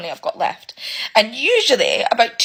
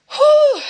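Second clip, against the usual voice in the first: a long, high, shaky exhale to steady herself; blowing out a breath to hold back tears; 6 dB louder than usual. Real, not acted. Single parent steadying herself mid-rant, shaky exhale. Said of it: "I'm getting really emotional".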